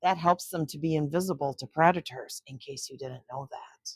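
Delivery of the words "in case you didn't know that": ordinary delivery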